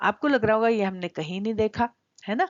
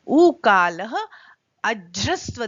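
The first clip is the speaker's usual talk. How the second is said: उकालः spelled out very slowly and loudly; deliberate loud breakdown of उकालः; slow, emphatic; 8 dB louder, much slower than usual. Shown as a demonstration. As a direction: sound out उकालः syllable by syllable. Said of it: "पहले हम यहाँ देखते हैं".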